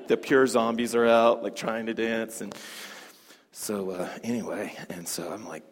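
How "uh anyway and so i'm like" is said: ordinary talk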